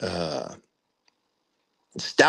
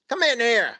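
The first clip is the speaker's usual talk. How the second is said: high, raised call into the office; imitating the first sergeant; 11 semitones higher than usual. First sergeant calling a passing soldier into his office, high mock call. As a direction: mimic the old first sergeant summoning you.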